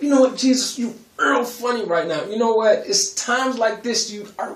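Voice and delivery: high, loud exasperated pleading; telling Jesus off in a high, playful whine; exasperated teasing. Retold or imitated. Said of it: "And I'm like".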